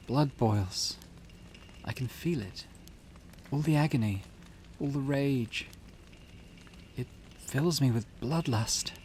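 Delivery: haunted, deliberate delivery with long pauses; cursed client. Acted. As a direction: relive a burning death, restrained but dramatic. Voices cursed client reliving agony.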